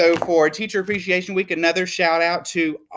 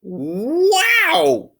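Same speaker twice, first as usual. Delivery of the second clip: high, louder awed wow; amazed wow; 12 semitones higher, 4 dB louder than usual. Acted. Teacher reads Lily's note, amazed high wow. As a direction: gasp it out in wonder. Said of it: "said Mister Slinger".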